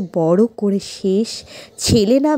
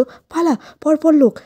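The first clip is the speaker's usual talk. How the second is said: raised, high cry to flee; urgent warning cry; 7 semitones higher, 5 dB louder than usual. Acted. Villager warning everyone as the huts are set alight, urgent cry. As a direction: call out a warning to the whole village.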